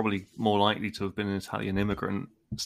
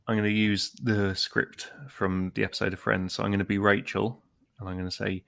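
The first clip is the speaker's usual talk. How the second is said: ordinary delivery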